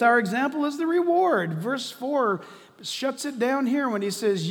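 full-force preaching. Real, not acted. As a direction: preach it with urgency, pitch up.

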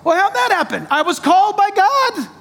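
excited call and answer. Acted. Acted exchange with Billy Graham.

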